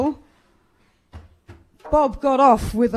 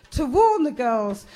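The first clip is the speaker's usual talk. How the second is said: usual speaking voice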